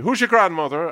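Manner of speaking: high, loud Irish landlady voice; landlady's voice. Retold or imitated. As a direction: bark it out like a brusque old landlady.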